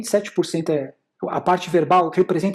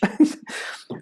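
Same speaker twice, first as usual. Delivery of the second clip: short laugh; 8 semitones higher, 4 dB quieter than usual. Real, not acted. Laughs at own joke.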